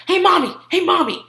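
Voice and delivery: high, loud mock toddler; imitating loud three-year-old. Three-year-old foster son calling to mommy, loud toddler imitation. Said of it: "this three-year-old is always talking to me".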